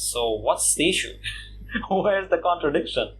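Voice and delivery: ordinary delivery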